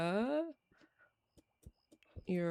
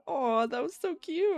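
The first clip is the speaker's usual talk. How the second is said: gushing aw; 10 semitones higher, 4 dB louder than usual. Retold or imitated. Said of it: "I was like".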